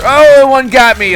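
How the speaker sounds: high, loud, slow startled cry; startled yelp. Real, not acted. Player caught by a hidden trap, real yelp. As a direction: cry out as the trap hits you.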